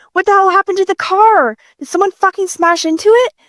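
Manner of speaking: high-pitched, startled questioning; alarmed disbelief. Acted. Onlooker finding a wrecked car, alarmed disbelief. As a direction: raise the pitch in shocked alarm.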